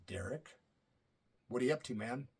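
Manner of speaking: low, quiet, stern boss voice; Jamie Markley, stern. Retold or imitated. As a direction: imitate a stern manager, dropped low and hushed. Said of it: "he's being very stern with his voice".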